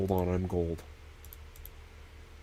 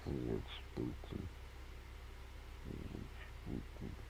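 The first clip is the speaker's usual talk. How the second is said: dropping lower and softer until gone; fading away; 11 semitones lower, 27 dB quieter than usual. Pretend. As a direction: let it sink into a breathless whisper. Repeating line, fading to nothing.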